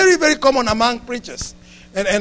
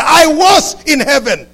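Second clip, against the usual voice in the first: loud, higher, slow mock boast; 4 semitones higher, 5 dB louder, slower than usual. Imagined heaven visitor boasting to a broadcaster, loud showy brag. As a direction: proclaim it slowly like a pretend celebrity testimony.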